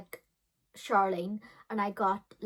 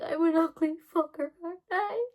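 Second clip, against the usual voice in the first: higher, tearful self-pity; self-pitying teen; 8 semitones higher, 3 dB louder than usual. Retold or imitated. Teen at the mirror insults herself, higher and tearful. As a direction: sob it at the mirror. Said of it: "I was like".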